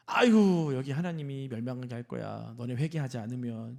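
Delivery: sighing, lackluster delivery of a warning; half-hearted preaching. Retold or imitated. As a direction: imitate someone grudgingly going through the motions. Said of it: "그냥 대충대충 말한 거예요".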